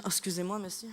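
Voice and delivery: very soft, trailing-away apology; hushed apology. Acted. Metro reader bumping a gentleman, hushed acted apology. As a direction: apologize quickly under your breath.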